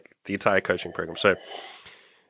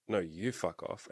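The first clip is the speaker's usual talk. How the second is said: quiet confused; 13 dB quieter, slower than usual. Retold or imitated. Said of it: "I was like".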